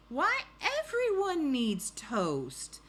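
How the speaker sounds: high, loud, slow exclamation; emphatic storybook insistence; gushing hostess. Acted. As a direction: draw it out high and loud as Mrs. Watson. Mrs Watson answers, voiced in story.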